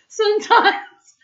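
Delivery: high, loud giggling; storyteller cracking up; laughing. Real, not acted. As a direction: laugh at the silliness. Narrator laughs at the silliness.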